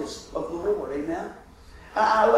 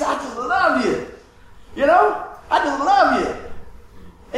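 tender, lingering, higher with a laugh; loving parent; 8 semitones higher, 5 dB louder, much slower than usual. Retold or imitated. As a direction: gush over your kids, slow and fond. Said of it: "You see your kids".

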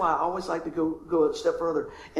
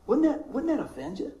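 ordinary delivery

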